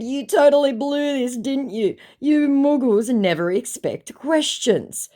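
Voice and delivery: high, loud gloating putdown; scornful retort. Retold or imitated. Woman telling the fake he blew this, scornful. Retelling her scornful chat reply.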